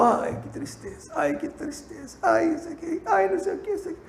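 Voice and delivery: high, whiny mock lament; imitating weeping patient. Pastor mimics the crying patient's lament, high and mock-tearful. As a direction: mimic a sobbing woman, hammed-up. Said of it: "Chorando, chorando, chorando".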